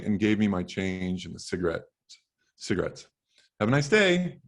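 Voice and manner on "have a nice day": bright cashier farewell. Acted. Cashier says goodbye.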